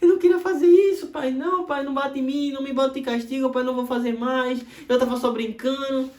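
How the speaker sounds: put-on high, loud, sobbing plea of a scared child; crying child. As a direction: beg through tears like a little kid about to be spanked. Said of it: "ele começa a chorar".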